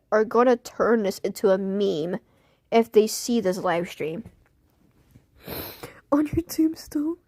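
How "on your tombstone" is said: whisper. Real, not acted. Joking aside.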